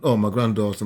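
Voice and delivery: ordinary talk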